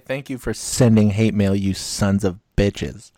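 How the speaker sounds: loud, slow mock rant; mock angry thanks. Host mock-yelling thanks at hate mail senders. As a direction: bellow the thanks like a mock insult.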